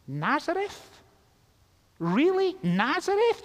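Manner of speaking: high, rising disbelief; incredulous. Acted. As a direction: question it in mock astonishment. Nathanael's disbelief at Nazareth, acted.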